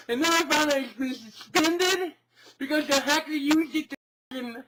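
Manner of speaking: disbelief turning to fury; outraged rant. Acted. Account suspended, outrage builds.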